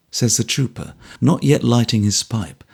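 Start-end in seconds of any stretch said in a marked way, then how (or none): none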